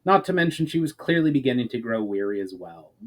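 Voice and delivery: plain reading voice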